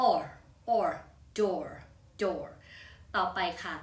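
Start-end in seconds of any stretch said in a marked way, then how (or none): 0.1-2.5 "or", then "door", each a separate model twice; repeat-after-me model. Shown as a demonstration. Teacher telling students to repeat after her, "or" and "door" pronounced separately. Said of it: "ลองพูดตามครูลี่ดู".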